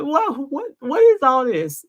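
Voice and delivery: shocked disbelief. Retold or imitated. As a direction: act out his stunned what is all this. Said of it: "He says it like".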